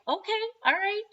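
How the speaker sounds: mild surprise. Retold or imitated. Mom answering son's odd reply.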